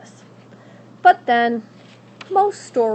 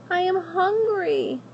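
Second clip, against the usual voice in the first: put-on high, pleading young princess; 11 semitones higher than usual. Princess begging at the witch's door, high and pleading.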